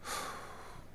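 a silent, breathy sigh through tears; tearful exhale; shaky tearful sigh. Real, not acted. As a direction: let out a trembling breath while crying. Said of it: "I'm sorry for crying on y'all".

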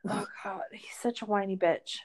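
a disgusted whispered jab; whispered aside. Real, not acted. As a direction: whisper the insult under your breath. Aside insult about Gil.

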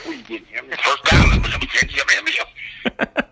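high, garbled Southern-Creole mumbo jumbo; jumbled Southern babble climbing high in pitch; strange Southern-Creole accent. Retold or imitated. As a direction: mimic a friend's baffling Mississippi accent. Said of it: "this guy had the strangest accent".

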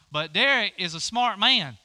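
ordinary talk, nothing marked

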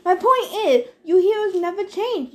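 high, loud rebuke; irritated scolding. A narrator's reading. God rebukes heroes for never changing, irritated. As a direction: cut in high and forceful.

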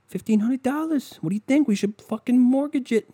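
high, loud dudebro voice; mocking dumb neighbor. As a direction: ham up a clueless neighbor bragging. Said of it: "these fucking people are like".

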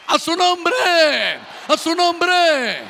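high, loud call with long-held last syllable; rallying church shout. Real, not acted. Preacher calling the church to praise His name, rallying shout. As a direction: shout it out to rouse the congregation. Preacher calls for congregation's response.

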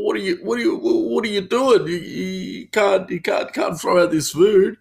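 hammed-up dismay, words stretched out; imitated whining protest. Imitates sleepy staffer protesting thrown-out food.

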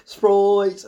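loud, high mock-accent shout; Jon Snow. Reviewer mocks a character's stupid accent, shouted imitation. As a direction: bellow the name in a silly put-on accent. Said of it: "saying it in that stupid accent".

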